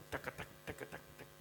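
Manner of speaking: soft whispered drum syllables taka tak; mirdanga drum imitation. Teacher showing how expert drummers make the drum talk, in soft whispered drum syllables. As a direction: tap out the mridanga rhythm with your voice, barely voiced. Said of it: "And they talk like that".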